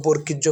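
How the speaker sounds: বর্কির্য with k-i, bor-kij-jo; common mispronunciation. Shown as a demonstration. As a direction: run বর্কির্য together with a k. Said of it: "কোনোটাই সঠিক নয়।".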